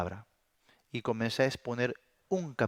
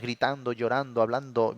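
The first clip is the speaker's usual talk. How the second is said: ordinary talk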